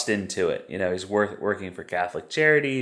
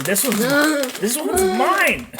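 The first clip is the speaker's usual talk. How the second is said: much higher, slow, sing-song dog voice; pet voice; 5 dB louder than usual. Pretend. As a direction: speak for the dog, high and drawn out. Voicing the dog's toy claim.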